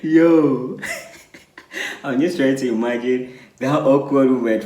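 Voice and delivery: real laughter breaking into the words; laughing reaction. Real, not acted. Host reacting to the awkward moving-in story, really laughing. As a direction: crack up while talking.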